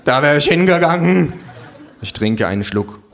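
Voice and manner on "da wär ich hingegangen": laut und hoch schwärmen; betrunkene Begeisterung. Acted. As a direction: lautstark als glühender Fan beteuern. Betrunkener Fan schwärmt vom Auto. (laute, angetrunkene Schwärmerei)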